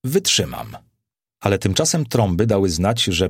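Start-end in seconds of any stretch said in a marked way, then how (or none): 0.0-0.8 low, strained-effort reply; understated effortful answer, dropped in pitch. A narrator's reading. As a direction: force the word out, pitched low. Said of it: "jakby z pewnym wysileniem".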